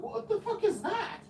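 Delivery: high-pitched mock disbelief; retold squeaky bewilderment; baffled outburst. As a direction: react as if totally thrown by the news. Retells his baffled reaction to the confession.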